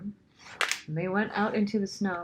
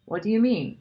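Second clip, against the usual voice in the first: a louder, higher startled cry; cried out; 6 semitones higher, 7 dB louder than usual. A narrator's reading. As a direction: gasp it out in sudden alarm. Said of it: "cried Lucy".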